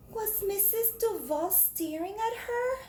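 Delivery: high, soft, drawn-out question; suspenseful question. Acted. Reader asking the children, high and hushed. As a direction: ask it with suspense. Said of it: "suspense question to children".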